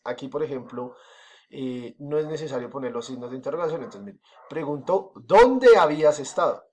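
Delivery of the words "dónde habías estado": slow, loud "¿Dónde" asked with question tune; question read aloud. Shown as a demonstration. Teacher reads the example question to students, slow and loud. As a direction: lean hard on "Dónde" and ask it slowly. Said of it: "La palabra interrogativa es "dónde".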